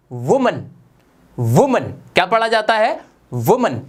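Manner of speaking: WOMAN as वुमन, mouth wide open, falling; over-articulate वुमन with a wu start; exaggerated articulation. Shown as a demonstration. Teacher modelling वुमन for students to copy, slow and over-articulated. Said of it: "मैं बहुत ज्यादा मुंह खोल के".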